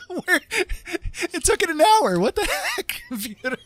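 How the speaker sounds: high, laughing exasperation at the computer; giddy disbelief. Real, not acted. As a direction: streamer teasing the laggy computer, high and giddy. Joking at slow donation alert.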